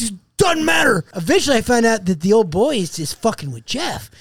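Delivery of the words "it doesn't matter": raised-pitch mock irritation; mad. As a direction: imitate a friend angrily waving it off. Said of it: "starts getting really fucking mad".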